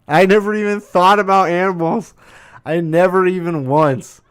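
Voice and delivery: high, loud, incredulous; amazed outburst. Real, not acted. Amazed that animals go unvaccinated too, high incredulous outburst. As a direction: burst out in real astonishment.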